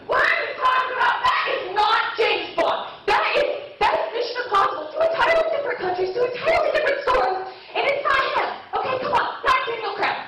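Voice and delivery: high, rapid-fire mock rant; indignant outburst. Bond fan snaps at girl in cinema, indignant. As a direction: finally lose it at a clueless fan, hammed up.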